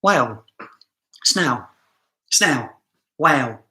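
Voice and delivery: "whale" and "snail" as wow and snow-like "snaow"; southern English "Wow", "Snail" without the final L; dropped L. Shown as a demonstration. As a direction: say "Snail" as snaow, L turned to a vowel. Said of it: "I don't pronounce the L".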